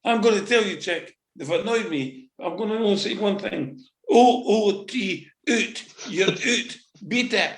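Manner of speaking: high, cocky send-off; defiant dismissal. Retold or imitated. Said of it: "That was my statement on the radio".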